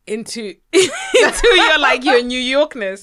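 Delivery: high, loud laughing. Real, not acted. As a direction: laugh while teasing. Laughing while teasing friend.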